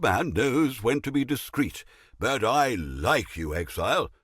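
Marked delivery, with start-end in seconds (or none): none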